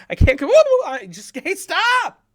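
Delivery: very high, loud outburst, fighting itself; squeal, then yell at yourself to stop; overexcited. Pretend. Host trying to stop the weird outbursts, overexcited. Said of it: "when I get too excited".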